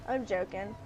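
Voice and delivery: ordinary talk, nothing marked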